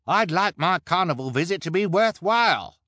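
high, loud demanding shout; impatient cry. A narrator's reading. Spectator demanding a worthwhile carnival show, high impatient cry. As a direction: complain loudly at a high pitch. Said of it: "cried the man with pimples".

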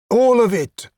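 very high, sharp command; appalled order. Acted. Woman ordering a half-dressed man to cover up, appalled. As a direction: snap it out, pitched right up.

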